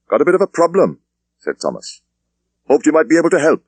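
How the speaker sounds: a superintendent dismissing his junior; police superintendent. Acted. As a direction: wrap it up with brisk authority. Thomas asks friend.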